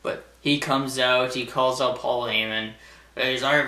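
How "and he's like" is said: ordinary delivery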